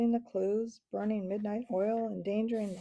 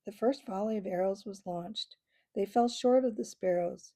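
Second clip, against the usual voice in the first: plain reading voice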